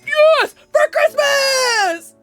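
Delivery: high, loud drawn-out battle cry. Real, not acted. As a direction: yell it as a charge.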